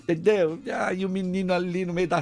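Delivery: mild mock auntie; doting aunt. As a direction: coo over the boy like a fussy aunt. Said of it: "tem um monte de tia dizendo".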